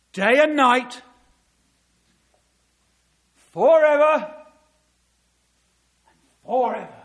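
proclaiming scripture. Acted. Preacher to congregation, thundering the verse loudly. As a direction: call it out over the hall. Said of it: "he says this".

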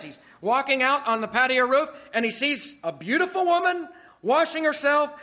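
loud, high, driving sermon voice; preaching at full force. Acted. Preacher pressing the congregation hard, loud and high.

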